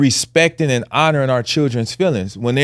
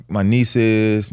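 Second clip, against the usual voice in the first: usual speaking voice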